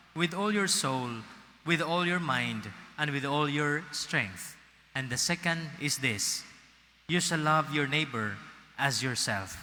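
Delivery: ordinary talk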